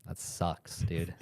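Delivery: usual speaking voice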